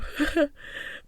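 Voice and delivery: breathy laugh through tears; teary laugh. Real, not acted. Laughing off her tears before the happy ending. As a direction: release with a little teary laugh.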